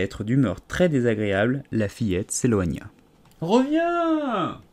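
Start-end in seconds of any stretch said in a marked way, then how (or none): 3.4-4.6 very high, held call of a caterpillar. A narrator's reading. Caterpillar calls the departing Alice back. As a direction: call her back across the distance. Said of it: "lui cria la chenille".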